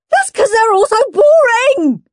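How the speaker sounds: very high screech of complaint; high-pitched tantrum screech; screeching child. A narrator's reading. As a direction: screech it like a furious little boy. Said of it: "screeched Henry".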